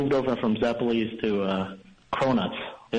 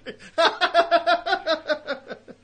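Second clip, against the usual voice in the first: long, high-pitched laugh. Real, not acted. Caller after describing Honolulu temperatures to host, long high laugh. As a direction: laugh high and keep it going.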